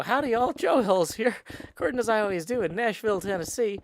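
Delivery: high, bright YouTube intro greeting; Joe Hills intro impression. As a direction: open the video like a cheery host. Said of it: "I put the object down and go".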